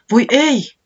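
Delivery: high, loud cry of dismay; bunny suddenly remembering the undelivered papers; sudden exclamation. A narrator's reading. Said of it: "huudahti säämies yhtäkkiä".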